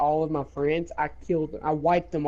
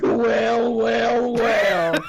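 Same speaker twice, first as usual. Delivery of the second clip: slow, louder smug gloat; gloating villain; 4 semitones higher, 4 dB louder, much slower than usual. Retold or imitated. Cole gloating over his opponent's weak roll, smug villain gloat. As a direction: relish the moment like a cartoon villain.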